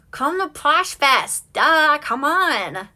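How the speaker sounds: teasing a friend to come to Posh Fest, loud and slow; playful urging. Real, not acted. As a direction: drag it out to coax a friend into coming. Urging viewer Kristen to come.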